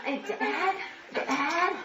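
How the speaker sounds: high, soft, sing-song greeting; sing-song girl. Retold or imitated. Daughter greeting the bus driver, high and sing-song. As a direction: tease it out brightly.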